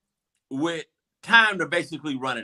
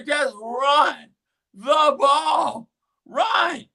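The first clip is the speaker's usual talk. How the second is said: high, drawn-out mock wail; exasperated plea; 9 semitones higher, 3 dB louder, much slower than usual. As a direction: plead at the team, voice pitched way up. Acting out pleading from the stands.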